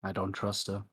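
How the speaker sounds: softly confided aside; hushed, confidential undertone; very quiet. Acted. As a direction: say it low, just for one listener. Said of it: "Very quietly, just gonna go".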